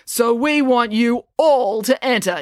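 slow, high rallying call; yelling. A narrator's reading. Brad inviting all the town to enter, yelling. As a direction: urge the whole crowd to join. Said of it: "yelled Brad".

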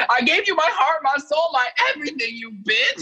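imitating an unhinged, very high-pitched tirade; mock hysterical outburst in a very high voice; Eden Sassoon. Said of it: "that was an unhinged woman, Eden Sassoon".

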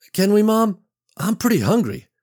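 put-on young boy asking. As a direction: beg your mom like a hungry kid. Child asks mother for food.

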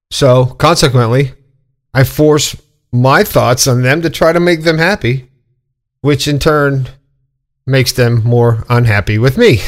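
ordinary delivery

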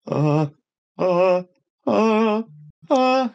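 panicked stammer rising step by step. Retold or imitated. Friends all stare at him.

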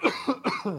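sudden harsh coughing fit; choking cough. Real, not acted. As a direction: cough hard, choking on spit. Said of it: "Choking on spit".

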